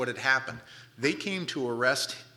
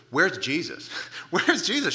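urgent demand; 6 semitones higher, 6 dB louder than usual. Retold or imitated. Acting out Mary seeking Jesus.

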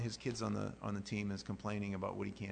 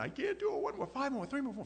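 high strained mock yelling of a tired kid; mock scream; 3 dB louder than usual. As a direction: yell like you're about to give out. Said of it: "the kid's, like, screaming".

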